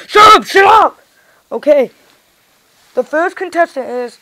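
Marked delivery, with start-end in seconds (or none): 0.1-1.0 louder, higher angry yell; angry shout. Pretend. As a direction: snap at the other toy. Toys squabbling over host role.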